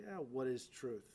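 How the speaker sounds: dry, mocking, unconvinced; sarcastic. Shown as a demonstration. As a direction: shrug off the question with scorn. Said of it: "did he say sarcastically".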